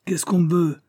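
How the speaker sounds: high, irritated call; shouted through door. A narrator's reading. Boss answering callers through the door, shouted. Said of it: "cria-t-il à travers la porte".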